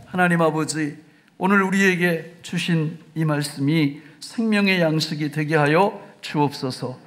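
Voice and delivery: low, earnest closing prayer. Acted. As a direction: pray it humbly before God.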